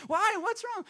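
very high mock-concerned husband; comically high pleading questions; clueless husband. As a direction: pitch it way up like a baffled husband. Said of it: "Any husbands relate to this".

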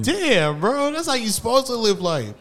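high, loud amazed exclaim. Retold or imitated. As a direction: gush in amazement. Amazed quoted reaction.

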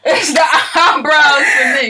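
slow, high, laughing cry; laughing shout. Real, not acted. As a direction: wail it through laughter.

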